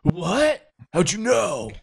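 high, sudden gasp of disbelief; startled character. Acted. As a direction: caught out, jolting up in alarm. Character caught off guard, revealed.

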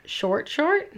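baffled by a typo; puzzled query. Real, not acted. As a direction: repeat the odd words in disbelief. Puzzled at a typo while reading.

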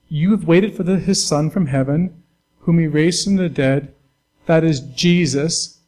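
loud, slow reading of the verse; scripture reading. Acted. Pastor reads verse ten to the church, loud and slow. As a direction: proclaim the verse loudly and slowly.

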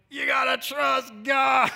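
high, loud, slow preacher impression; mock pulpit shout, words stretched; raspy preacher. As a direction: rasp it out like a revival preacher. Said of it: "In that raspy preacher voice".

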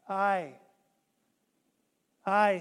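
put-on "I" drawn out in a light, broad Essex accent; a hint of a country Essex preacher. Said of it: "in a broad Essex accent".

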